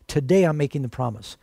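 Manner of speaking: "Today" hit first and hard, falling; stress on TODAY. Shown as a demonstration. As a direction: lean on the word "Today". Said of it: "Why did Jesus emphasize".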